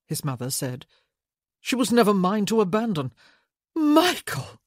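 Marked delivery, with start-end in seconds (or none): 1.6-3.1 louder, bitter retort; heated outburst. A narrator's reading. Heated reply to his mother. 3.7-4.6 high shocked cry of his name; shocked gasp. A narrator's reading. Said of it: "Helen gasped".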